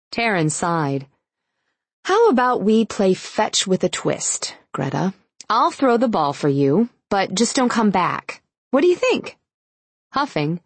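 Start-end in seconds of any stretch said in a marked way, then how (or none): 2.0-9.4 sarcastic. A narrator's reading. Taryn mocks Greta.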